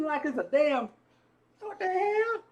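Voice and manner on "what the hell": startled disbelief. Retold or imitated. Retelling shock at woman appearing.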